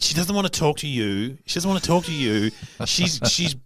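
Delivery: a hint of a fierce protective girlfriend, pitch up; mock-warning a guy off her friend. Friend acting protective toward a young guy in the bar, lightly voiced mock warning. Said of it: "Oh, the protective one".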